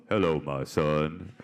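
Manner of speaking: deep, slow, booming voice; operator's voice. Retold or imitated. Operator relaying mom's words to her son, deep booming voice. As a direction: boom it out low and slow like God. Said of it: "That's whose voice you would hear".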